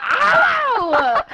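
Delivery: long, high, falling "ow"; retold pained yelp. Reacting to Axel's smack, pained yelp. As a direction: yelp it in mock pain, half laughing.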